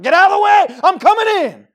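high, full-volume impatient shout; screamed, headlong outburst; brash yelling. Retold or imitated. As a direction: yell it as you barge through. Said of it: "he was yelling and screaming".

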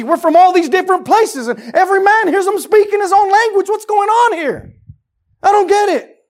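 loud, high amazed questions; bewildered crowd. Retold or imitated. Men at Pentecost marvel at the tongues, loud and bewildered. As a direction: sound utterly baffled by the tongues.

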